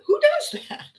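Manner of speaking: high, half-laughing disbelief; laughing quote. Retold or imitated. Imitating the shopper laughing at the staple counting, high and giggly. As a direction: laugh through the words as you quote him. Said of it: "He's laughing".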